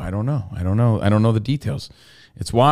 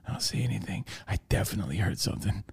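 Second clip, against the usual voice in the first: very soft, secretive self-talk; hushed re-enacted suspense; acted whisper; 7 semitones lower, 14 dB quieter, faster than usual. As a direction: whisper to yourself while sneaking about. Creeping into bathroom, muttering to self.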